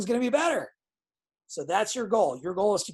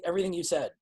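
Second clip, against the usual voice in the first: ordinary talk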